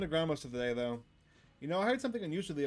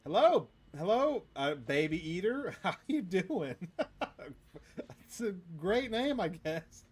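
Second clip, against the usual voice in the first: higher and louder, giggling through it; laughing greeting; 6 semitones higher, 5 dB louder, slower than usual. Real, not acted. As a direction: greet while cracking up. Greeting the Baby Eater with laughs.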